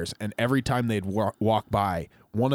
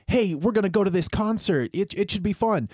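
higher, bright imitation of a wife; mock wife voice; 9 semitones higher than usual. Imitating a wife inviting her husband to a concert, higher. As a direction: lightly voice the wife's chirpy invitation. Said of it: "your wife's like".

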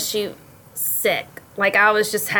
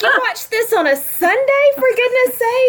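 high, louder, incredulous; mock scandalized husband; 5 dB louder than usual. Husband scolding her for Sunday viewing, high and scandalized. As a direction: act out an appalled husband.

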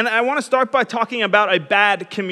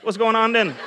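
imitated Bahamian greeting; casual, put-on Bahamian lilt; Bahamian accent. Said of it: "I would talk like them".

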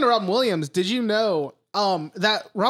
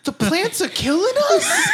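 laughing mock line; 3 dB louder than usual. Mocking Wahlberg's line, laughing.

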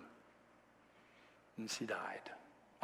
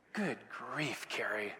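muttered annoyance; 6 semitones lower, 12 dB quieter, slower than usual. Retold or imitated. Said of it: "And I was ticked".

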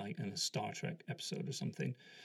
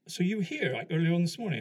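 louder, higher, probing officer's voice; questioning colonel; 8 semitones higher, 8 dB louder, faster than usual. Retold or imitated. Colonel checks the interviewee's account, questioning. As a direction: query his story with raised pitch and volume.

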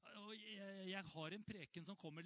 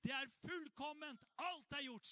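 cried out; 9 semitones higher, 4 dB louder than usual. Retold or imitated. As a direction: proclaim it like the cry from the cross. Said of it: "Jesus ropte ut på korset".

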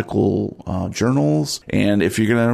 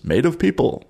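dramatic movie quote; 7 semitones higher than usual. Pretend. Repeats the quote.

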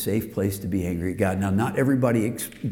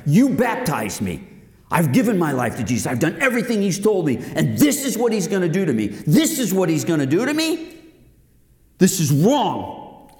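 angry protest; 6 semitones higher than usual. Retold or imitated. Friend angry at God after trying for a baby, outraged. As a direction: protest in rising disbelief, pitch climbing.

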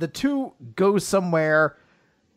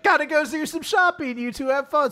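much higher, sing-song teasing friend; perky friend voice; 11 semitones higher than usual. Retold or imitated. The friend leaving the couple alone, high teasing voice. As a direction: chirp it while slipping away.

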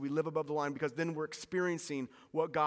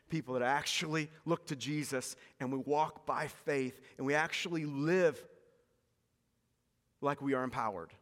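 ordinary talk, nothing marked

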